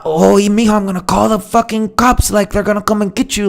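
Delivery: high-pitched Colombian mother caricature; Colombian mom. Acted. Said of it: "it's never like".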